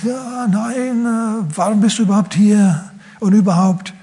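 high, squirming demon voice. Acted. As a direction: play the cornered demon, high and evasive. Demon answers Jesus.

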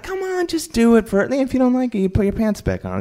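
very high mock wheedling; coaxing. Guests urging Dave to just do it, coaxing. Retold peer pressure to strip.

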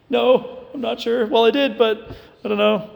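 husband Joseph fumbling his answer to Mary, mock flustered. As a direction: stammer out an excuse, playfully.

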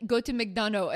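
put-on "McDonough" with swallowed French-accented ending; heavy French accent. Bartender recommending where to eat, heavy French accent. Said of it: "very heavy accent".

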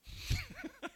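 short high laugh; a quick high burst of laughter. Real, not acted. Preacher laughing at his own Jonah outburst, a high laugh.

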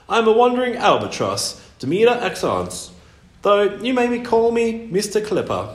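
high, loud self-important bird; pompous albatross. A narrator's reading. Albatross introducing himself to a boy, pompous.

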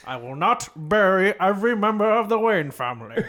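hammed-up old British butler, loud and high; Michael Caine. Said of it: "kind of like a Muppet version of Michael Caine".

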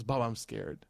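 soft, timid little plea; imitated scared child. Son to father before punishment, small scared voice. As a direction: mimic a child confessing softly to dad.

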